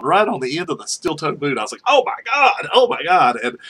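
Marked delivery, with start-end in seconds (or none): 1.9-3.4 high, loud mock alarm; retold panicked shock. Man with a boar clamped on his boot, alarmed. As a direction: cry out as if something just grabbed your boot.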